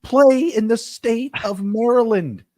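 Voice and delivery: high-pitched, worked-up insistence; excited sports-fan pleading; impassioned argument. Real, not acted. Hyped argument urging co-host to bet.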